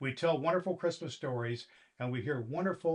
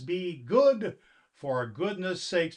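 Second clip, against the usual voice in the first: slow catchphrase; 4 semitones higher, 6 dB louder, slower than usual. Acted. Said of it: "Santa Claus says".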